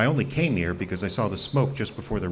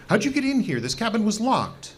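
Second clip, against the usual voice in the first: startled indignation; 13 semitones higher than usual. Acted. Startled hermit confronts intruders.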